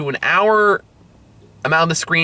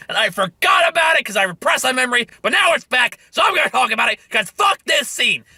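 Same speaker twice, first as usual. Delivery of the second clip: angry outburst; 5 semitones higher than usual. Real, not acted. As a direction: blow up at a hated movie scene. Sudden angry outburst about scene.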